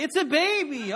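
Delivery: loud mock exasperation, it's a baby; exasperated. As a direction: deflate the doting parents with disbelief. Mocking over-proud parents.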